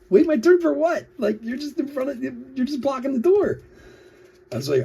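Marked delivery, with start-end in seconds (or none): 0.1-3.6 much higher, disbelieving retort; incredulous. Retold or imitated. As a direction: act baffled she'd say that. Retells his indignant reply.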